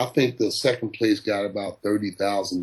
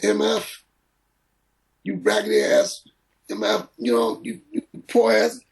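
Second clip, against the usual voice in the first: high, mock-furious Don King rant; Don King cursing; 10 semitones higher than usual. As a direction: spit the insults up high like Don. Don cursing him out, retold.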